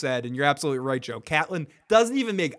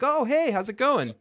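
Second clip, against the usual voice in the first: bright, much higher hello; cheery greeting; 9 semitones higher, 4 dB louder than usual. Retold or imitated. Tyrion greeting Lady Stark at the inn, mock cheery hello. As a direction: greet an old friend you're thrilled to see.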